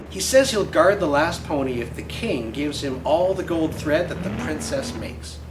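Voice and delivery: ordinary delivery, nothing marked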